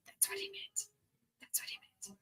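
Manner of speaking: whispered aside; hushed, unvoiced aside. Real, not acted. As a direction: breathe it, no voice.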